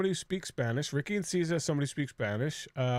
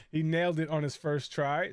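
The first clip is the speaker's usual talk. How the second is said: ordinary delivery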